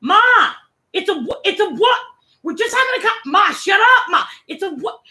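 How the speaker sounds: high-pitched mock teen protest; yelling daughter. Daughter arguing with her mother on webcam, imitated high yelling. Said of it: "her daughter's just yelling at her".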